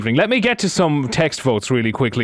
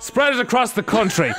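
put-on Irish accent; 7 semitones higher than usual. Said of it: "it's really funny 'cause then you go".

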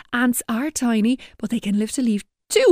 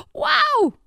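excited. A narrator's reading. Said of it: "I am very excited".

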